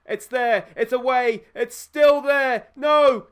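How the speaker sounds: building from nervy to a desperate cry; tense commentary. Real, not acted. Watching a goalmouth scramble.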